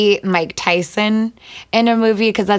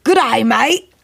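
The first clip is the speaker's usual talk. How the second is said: hammed-up Aussie greeting, higher pitch; Australian accent; 5 semitones higher, 4 dB louder than usual. Pop star asked in rapid-fire questions, playful Australian accent. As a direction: put on a broad, punchy Australian accent. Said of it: "in your best Australian accent".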